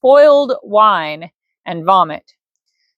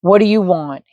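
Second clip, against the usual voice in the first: plain reading voice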